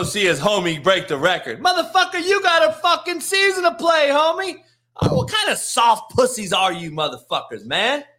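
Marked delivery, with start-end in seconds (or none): 1.6-6.9 a ranting host tearing into a player; high angry rant. Real, not acted. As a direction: yell it like he can't believe it. Ranting at Draymond Green.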